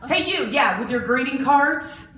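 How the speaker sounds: loud, high, sarcastic challenge; confrontational callout. Retold or imitated. Jenny loudly confronting the woman who cut, sarcastic. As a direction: call her out in front of everyone. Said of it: "Forty-seven-year-old Jenny said".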